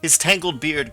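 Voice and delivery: ordinary delivery, nothing marked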